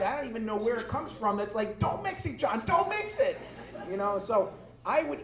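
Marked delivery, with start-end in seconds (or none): 1.8-3.4 high-pitched mock-panicked warning; urgent voice in your head, pitched way up; frantic inner voice. Acts out his frantic inner voice.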